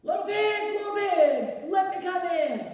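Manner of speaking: loud wolf call. Acted. Wolf calling at the third pig's door, loud. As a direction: shout it at the brick house.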